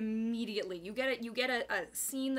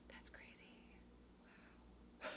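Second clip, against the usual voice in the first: whisper; 21 dB quieter than usual. Real, not acted. Reacting to viewer up early.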